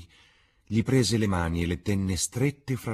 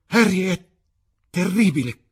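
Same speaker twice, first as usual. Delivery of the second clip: much higher, much louder desperate cry; anguished shout; 10 dB louder than usual. A narrator's reading. Dorian screams to Harry in horror. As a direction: scream his name in horror. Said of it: "gridò il ragazzo".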